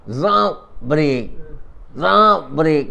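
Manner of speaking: loud high zap sounds for a sports car braking; loud mock braking noises; car brake noise. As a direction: blast out the zaps like screeching brakes.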